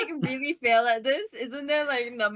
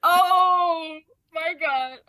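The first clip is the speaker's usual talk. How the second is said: loud, high, drawn-out groaning disbelief; real dismay; 5 semitones higher, 5 dB louder, much slower than usual. Real, not acted. Player facing a seventeen charm check, dismayed. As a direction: stretch it out slowly in dismay.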